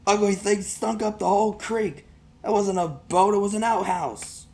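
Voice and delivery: put-on bully sneering at the boy's boat; mocking bully. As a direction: laugh while you insult it.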